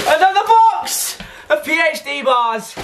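high laughing delight; excited laugh. Real, not acted. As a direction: laugh through the words. Laughing at more goodies.

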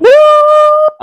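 high, loud cheering woo; celebratory woo. Real, not acted. Cheering the fiftieth episode, loud excited woo. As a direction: whoop for the big fifty.

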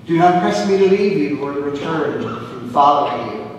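normal talking voice

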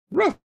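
high loud ruff; dog bark. Acted. Chicory the dog greets the girl: loud bark.

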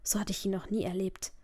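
ordinary delivery, nothing marked

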